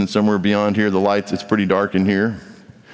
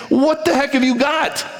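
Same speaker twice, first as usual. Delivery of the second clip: high, puzzled question from an outsider; curious onlooker. Retold or imitated. Stranger asking a believer about their hope, high and puzzled. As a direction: ask it like a baffled stranger. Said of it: "someone's gonna say".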